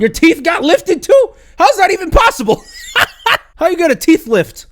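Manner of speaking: high, loud, disbelieving yell; incredulous outburst. Real, not acted. Reacting to a claimed teeth lift, incredulous. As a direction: burst out in comic disbelief.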